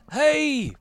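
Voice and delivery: high, drawn-out strained plea; king calling for attention. Acted. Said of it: "listen to what he's saying".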